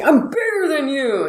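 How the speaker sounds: high mock yell; retold yell. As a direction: blurt it like a re-enacted holler. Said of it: "I yelled it at him".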